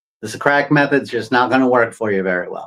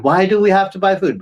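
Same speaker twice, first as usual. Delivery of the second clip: put-on little kid asking why; 6 semitones higher than usual. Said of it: "like you're a little kid".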